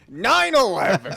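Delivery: loud, slow mock comedian squawk; hammed-up celebrity impression; Gilbert Gottfried. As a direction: drag it out loudly as a bad impression. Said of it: "every accent he does is Gilbert Godfrey".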